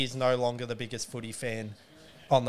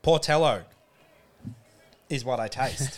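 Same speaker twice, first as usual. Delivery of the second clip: lips smacking after a sip; tasting then speaking; while sipping a drink; 4 dB louder than usual. Real, not acted. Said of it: "lip smacking".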